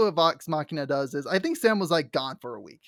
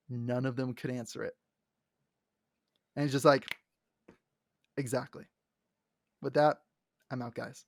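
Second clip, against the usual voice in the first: much softer, lower resigned exit; quiet hurt exit; 5 semitones lower, 7 dB quieter than usual. Retold or imitated. Scanlan leaving the party, quiet resignation. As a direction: let it drop quietly.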